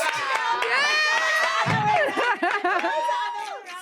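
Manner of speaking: a high hoot of laughter at the reveal; high burst of laughter. Real, not acted. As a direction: laugh out, delighted and shocked. Laughing after the virginity reveal.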